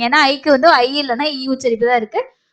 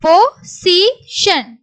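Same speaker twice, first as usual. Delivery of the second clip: slow, higher, separated syllables; 4 semitones higher, much slower than usual. Shown as a demonstration. Teacher sounds out the word for learners, syllable by syllable. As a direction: break "position" into three distinct pieces.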